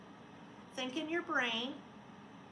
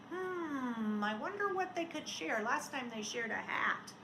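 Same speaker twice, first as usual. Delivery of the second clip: ordinary delivery, nothing marked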